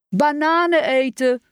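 put-on high, drawn-out child's call; narrator's light boyish yell; mild shout. A narrator's reading. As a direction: shout out a guess, stretched and high. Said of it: "schreeuwde Tijmen".

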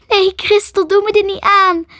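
high, loud anguished plea; crying out. A narrator's reading. Ellen to dying Crystal, begging, crying out. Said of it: "huilde Ellen".

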